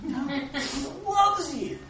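slow, lifted, emphatic correction; firm reassurance. Real, not acted. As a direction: insist on it like settling an argument. Answering the inner doubt.

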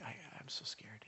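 barely voiced, breath-only confession of fear; scared whisper. Pastor retelling his fear before surgery, whispering. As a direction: let it slip out in a tiny whisper.

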